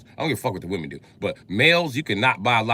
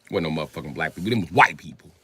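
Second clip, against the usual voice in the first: ordinary talk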